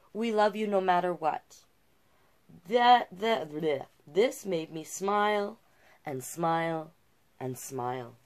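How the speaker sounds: bright, lively read-aloud listing hobbies; picture book narration. A narrator's reading. Reader shares Jazz's hobbies, lively storybook narration.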